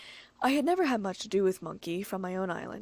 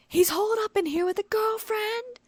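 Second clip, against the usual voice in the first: high, loud accusing shout; shrill yell; 13 semitones higher, 7 dB louder than usual. A narrator's reading. The stalker yelling accusations at Richon outside. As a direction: scream the accusation through the door. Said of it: "voice ran from outside".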